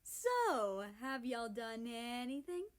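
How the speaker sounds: high, sly teasing tease. A narrator's reading. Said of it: "Canada teases".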